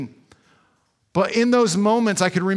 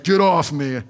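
laughing brush-off; 3 semitones lower, 3 dB louder than usual. Retold or imitated. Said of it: "And I'm like".

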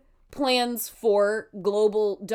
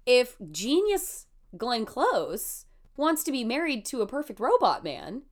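ordinary talk, nothing marked

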